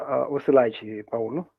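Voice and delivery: ordinary talk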